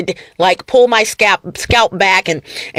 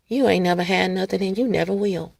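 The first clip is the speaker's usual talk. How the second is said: much softer, quietly menacing; soft and low; 4 semitones lower, 9 dB quieter than usual. Shown as a demonstration. Acting the insult for viewers, softly and lower. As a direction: say it under your breath, low. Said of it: "Let me say it another way".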